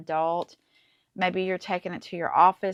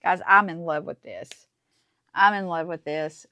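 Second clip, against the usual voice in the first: louder, excited declaration of love; gushing; 8 dB louder than usual. Real, not acted. Crafter telling viewers she loves her project, loud gush. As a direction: tell them you adore it.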